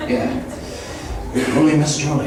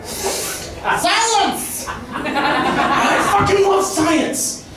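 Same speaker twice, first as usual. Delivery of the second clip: mock outburst; 11 semitones higher, much slower than usual. Mock outburst then laughing.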